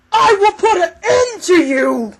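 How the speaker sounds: full-force, high-pitched monster scream; furious villain shriek; screaming threat. Acted. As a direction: scream the threat with everything you have. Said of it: "She screams, and her voice gets deeper".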